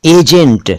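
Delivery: "एजेंट" starting on a narrow ay, EY-jent; careful word-list "एजेंट", mouth barely open; correct close vowel. Shown as a demonstration. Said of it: "एजेंट नहीं".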